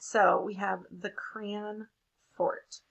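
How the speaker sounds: usual speaking voice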